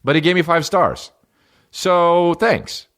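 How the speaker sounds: a high, bright sarcastic thank-you. Real, not acted.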